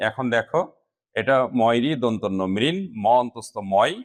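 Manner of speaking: ordinary talk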